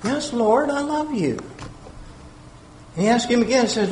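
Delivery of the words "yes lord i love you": higher and much softer, pleading; earnest answer. Acted. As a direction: insist gently from a higher voice. Peter answers again.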